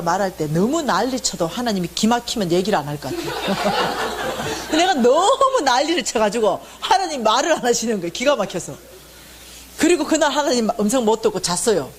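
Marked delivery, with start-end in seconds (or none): none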